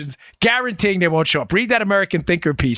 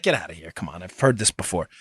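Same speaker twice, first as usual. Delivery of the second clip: skeptical scoff; 7 dB quieter than usual. Retold or imitated. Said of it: "I was like".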